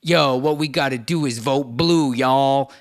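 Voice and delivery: loud mock rapper voice; a hammed-up rapper call to vote. Rapper urging fans to vote, mock loud. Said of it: "like seeing Eminem".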